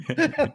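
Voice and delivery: high burst of real laughter; can't hold the laugh in. Real, not acted. Cracking up at his own punchline, high laugh.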